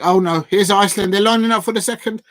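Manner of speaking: real rising alarm at an attack; alarmed commentary. Real, not acted. Watch-along host sees Iceland line up a second chance, alarmed commentary.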